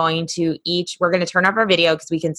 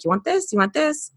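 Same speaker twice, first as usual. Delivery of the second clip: coaxing an animal; 9 semitones higher than usual. Retold or imitated. Coaxing a deer with apple.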